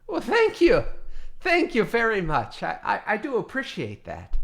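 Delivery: high, loud surprised thank you; touched thanks. Acted. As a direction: pleasantly caught off guard. Orc touched by compliment.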